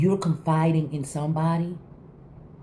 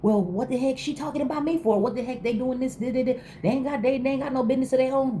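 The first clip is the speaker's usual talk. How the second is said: high-pitched indignant outburst; angry; 9 semitones higher than usual. Retold or imitated. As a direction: fire it off like you're fed up with gossip. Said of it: "You wanna get angry".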